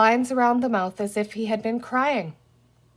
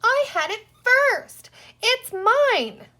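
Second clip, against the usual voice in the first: put-on much higher, louder childish whine; childish wail; 13 semitones higher, 5 dB louder, slower than usual. Little Patsy wailing over her toy, much higher. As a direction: whine like a toddler robbed of a toy. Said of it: "Patsy would wail in exactly the same tone".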